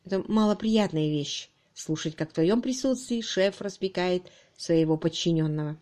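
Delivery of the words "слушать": usual speaking voice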